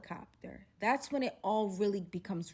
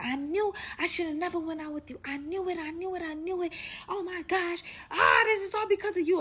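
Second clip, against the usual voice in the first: freaking out; 8 semitones higher, faster than usual. Retold or imitated. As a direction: wail the blame in mock panic. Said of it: "he's freaking out".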